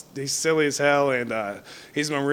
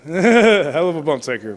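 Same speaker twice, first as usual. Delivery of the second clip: laughing through it, louder and slower; amused, laughing repeat; 2 semitones higher, 8 dB louder, slower than usual. Real, not acted. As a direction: chuckle the phrase out as you repeat it.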